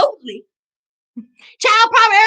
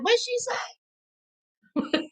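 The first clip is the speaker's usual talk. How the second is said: mock puzzlement; 6 semitones higher, 10 dB quieter, much slower than usual. Imagined viewers puzzled by her accent.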